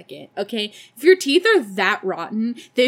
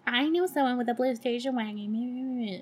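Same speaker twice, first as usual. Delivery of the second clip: mock big-mouth snitch; Rosemary; 7 dB quieter than usual. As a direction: mimic a blabbermouth in a silly voice. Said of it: "Rosemary's big fat mouth being like".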